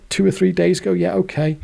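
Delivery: bank dialogue. Retold or imitated.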